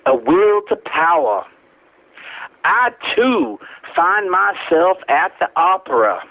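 slow, deliberate poetry reading with a Southern lilt; poem reading. Acted. Poet reading his verse to the radio audience, measured and dramatic. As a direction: read it like a spoken-word poet on stage.

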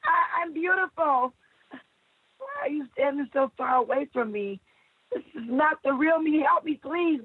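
high, pleading voice; high anguished plea to the doctors; high desperate plea. Acted. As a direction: beg the doctors to see you. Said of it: "I screamed".

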